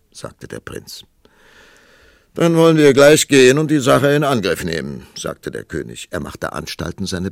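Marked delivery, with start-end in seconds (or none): 2.3-5.1 loud, booming king; roaring tyrant king. Acted. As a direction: command it like a gruff ruler. King decides to proceed.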